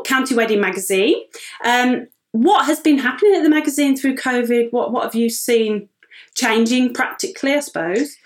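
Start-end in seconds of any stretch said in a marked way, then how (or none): none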